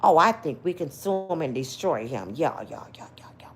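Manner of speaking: low conspiratorial whisper; hushed greedy scheming. Retold or imitated. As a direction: whisper the plan like plotting a lawsuit. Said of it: "let me tell you what happened".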